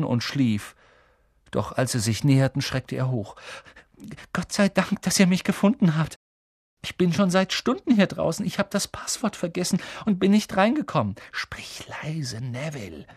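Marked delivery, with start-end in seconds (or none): 4.3-11.2 high, plaintive rush of relief; relieved outpouring. A narrator's reading. Neville, locked out for hours, greets his rescuers, relieved. 11.3-13.1 soft, breath-only shushing; hushing whisper. A narrator's reading. Harry tells Neville to keep it down, whispering.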